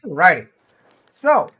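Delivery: loud, high alrighty; bright loud. Real, not acted. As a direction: perk up for the next part. Energetic wrap-up.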